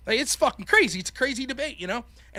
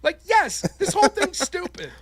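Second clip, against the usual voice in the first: high, raised-voice disbelief; exasperated outburst; 11 semitones higher, 4 dB louder than usual. Retold or imitated. Debater answering the judge's apology, exasperated outburst. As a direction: burst out at the absurdity of it all.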